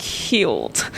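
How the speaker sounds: softly overwhelmed; hushed relief. Real, not acted. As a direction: let the word sink, breathy with relief. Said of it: "I just felt, like".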